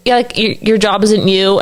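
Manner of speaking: normal talking voice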